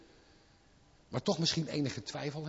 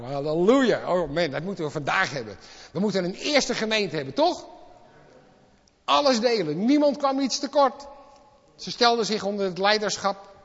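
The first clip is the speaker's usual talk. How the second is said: excited preaching; 3 semitones higher, 7 dB louder than usual. Real, not acted.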